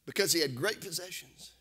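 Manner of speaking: hushed. Acted. Preacher hushes over sorrowful story.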